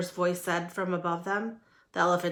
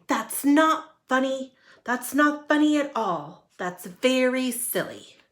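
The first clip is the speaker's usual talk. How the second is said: annoyed; 5 semitones higher, 4 dB louder than usual. Acted. Annoyed rabbits scolding.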